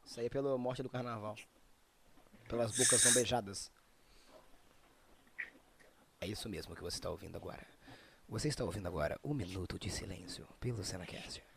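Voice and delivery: deep, hushed mock announcer; grave mock broadcast voice; solemn announcer. Mock solemn minute of silence.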